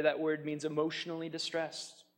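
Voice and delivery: normal talking voice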